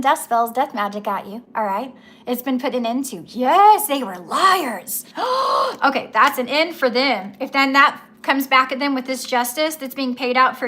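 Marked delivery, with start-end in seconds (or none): 5.1-5.8 surprised gasp. Real, not acted. Shocked at the liars.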